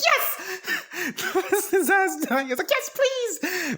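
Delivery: high, laughing yes; gleeful mock cheer. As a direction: cheer with wicked delight.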